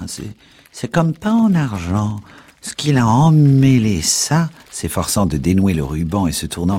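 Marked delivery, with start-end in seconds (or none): 0.1-4.5 voix de vieille plus aiguë, forte et lente; vieille agacée. A narrator's reading. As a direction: grommeler en vieille impatiente. Old woman fiddles with the pledge. (vieille usurière agacée, aiguë et lente)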